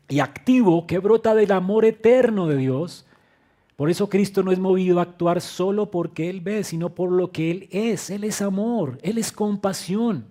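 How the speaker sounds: fervent sermon climax; full-force preaching. Acted. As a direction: proclaim it with rising conviction.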